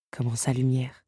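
ordinary delivery

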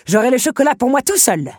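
high, loud playful taunt; gleeful beast calling back; gloating shout. A narrator's reading. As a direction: crow it over your shoulder while running. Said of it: "répliqua la bête par-dessus son épaule".

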